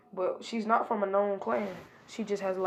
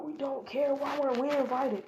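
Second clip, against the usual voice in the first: indignant; 6 semitones higher than usual. Retold or imitated. Sakura and Sasuke barge in complaining.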